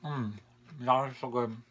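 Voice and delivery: talking while chewing; muffled words between bites; mouth full. Real, not acted. Seen 0: Food taken in at -2; jaw works with lips closed while speaking.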